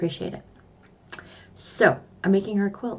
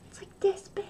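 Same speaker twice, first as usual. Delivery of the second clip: whisper; 13 semitones higher, 8 dB quieter than usual. Shown as a demonstration.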